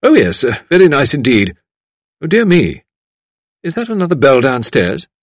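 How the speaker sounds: polite, distracted fluster; flustered visitor. A narrator's reading. Visitor distracted by the bell downstairs, flustered. Voices fussy visitor Miss Mullins.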